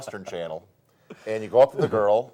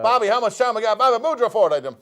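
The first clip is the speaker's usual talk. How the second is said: high, loud call to the booth; calling out to Bobby; 12 semitones higher, 7 dB louder than usual. Real, not acted. Host calling to producer Bobby in the booth, loud and high. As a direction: shout across the studio to the producer.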